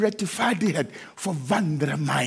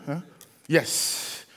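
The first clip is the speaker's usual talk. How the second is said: usual speaking voice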